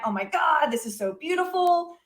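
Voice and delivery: a mild mock squeal of wonder; retold awed screaming. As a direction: imitate yourself marveling, pitch lifted. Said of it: "just screaming out the window".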